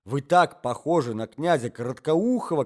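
usual speaking voice

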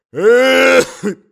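very loud, high hacking fit; old man's cough. Acted. Old husband interrupts himself before his wife, violent cough. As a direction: burst into a long acted coughing fit.

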